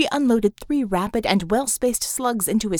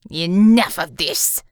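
loud, slow disgusted snap; 3 semitones lower, 7 dB louder, slower than usual. A narrator's reading. Villain ending the banter, loud disgust. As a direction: cut them off in disgust. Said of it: "said in disgust".

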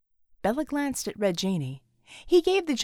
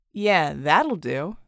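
genie; 6 semitones lower, 3 dB louder, much slower than usual. Acted. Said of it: "the genie said".

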